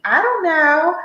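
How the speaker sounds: high-pitched, noncommittal answer; hammed-up high indecision; indecisive woman. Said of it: "when they ask the woman".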